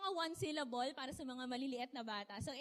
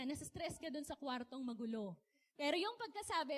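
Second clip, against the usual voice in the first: ordinary delivery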